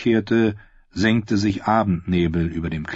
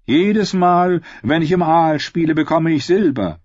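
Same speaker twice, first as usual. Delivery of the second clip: high, raised-voice brag; boasting; 9 semitones higher, 4 dB louder than usual. A narrator's reading. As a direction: show off as the cocky singer. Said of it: "prahlte er". (prahlerisch, mit hoher Stimme)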